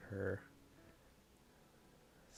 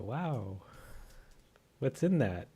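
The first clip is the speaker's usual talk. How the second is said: mock surprise; 2 semitones higher, 6 dB louder than usual. Mock reply to the perfume ad.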